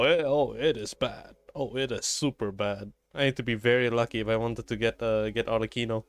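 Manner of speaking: normal talking voice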